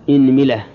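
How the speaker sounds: إنملة as "inmila", i after hamza and mim; kasra on both. Shown as a demonstration. Teacher completing the kasra set, إنملة as "inmila". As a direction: say إنملة with opening i and middle i. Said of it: "كسر الهمزة".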